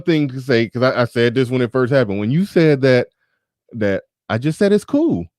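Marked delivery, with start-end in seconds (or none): 4.7-5.3 "cool" said high, imitating her vowel; high-pitched copy of a British "cool"; imitated British cool. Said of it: "That's exactly how you said it".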